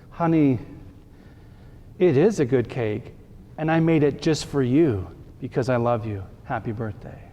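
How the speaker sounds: soft, gentle loving grandma; tender loving reassurance. Shown as a demonstration. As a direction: say it softly, as a grandmother giving a gift. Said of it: "It would have been better to say".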